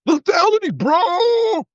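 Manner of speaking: very high, hammed-up bro cry; mock gung-ho yelp, drawn out at the end; military bro. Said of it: "anybody talk like this".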